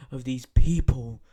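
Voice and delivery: sudden loud burst; loud outburst. Real, not acted. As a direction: punch it out loud. Rant peaks.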